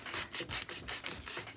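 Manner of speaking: soft unvoiced tsk-tsk; cheeky hissing monkeys; teasing monkeys. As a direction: monkeys tutting back at the peddler. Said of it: "the monkeys only stomped their feet at him and said".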